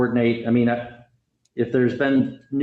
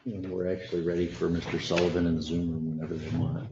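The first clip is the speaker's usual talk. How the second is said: soft, lower-pitched aside; quiet aside; 5 semitones lower, 9 dB quieter, faster than usual. Real, not acted. Judge telling staff about the next case, in a quiet aside. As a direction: keep it low, off to the side.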